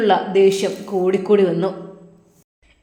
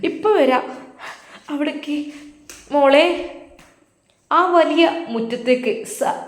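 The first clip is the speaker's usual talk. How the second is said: ordinary delivery, nothing marked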